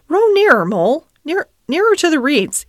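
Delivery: high, loud urging; urgent. Acted. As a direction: call out eagerly to the rower. Rat urgently calls Mole nearer.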